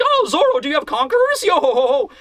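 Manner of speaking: high-pitched cartoonish teasing; playful character voice ending in a hammy chuckle; joking. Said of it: "that little joke they were making".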